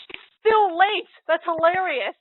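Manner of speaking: high, loud, slow delighted cry; real giddy delight; amused outburst. Real, not acted. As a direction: squeal with amusement. Laughing at the late heroine.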